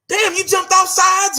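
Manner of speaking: high-pitched exasperated heckle; mock reproach. Acted reproach to players.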